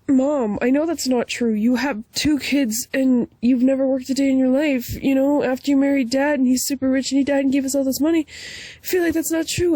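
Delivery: put-on a touch of whiny kid correcting mom. Child calling out mom's exaggeration in put-on voice. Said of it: "I just realized I was doing a voice".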